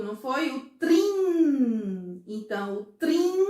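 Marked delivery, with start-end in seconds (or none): 0.7-2.2 "trim" drawn out on the nasal ending; a long, sustained "trim" for the pupils; held syllable. Shown as a demonstration. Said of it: "Eu falei junto". 2.9-3.4 "trim" high, held on the nasal ending; a raised, sustained "trim" for the pupils; held syllable. Shown as a demonstration. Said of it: "ele vai até a letra N".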